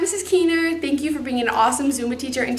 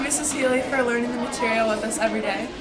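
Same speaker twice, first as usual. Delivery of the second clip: normal talking voice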